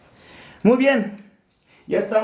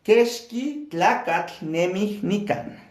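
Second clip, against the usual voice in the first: slow model reading; slower than usual. Shown as a demonstration. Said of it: "la oración número dos".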